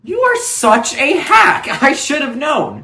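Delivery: high, loud outraged accusation; angry. Acted. Angry at producer over money.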